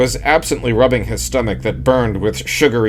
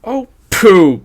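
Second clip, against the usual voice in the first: high, louder playful chiding; wife chiding husband, playful; 10 semitones higher, 6 dB louder than usual. A narrator's reading. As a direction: scold him sweetly from up high. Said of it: "Gladys chided".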